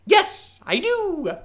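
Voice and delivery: very high, loud goblin reply; young goblin answering the traveller. Acted. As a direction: chirp it like an eager goblin. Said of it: "this young goblin".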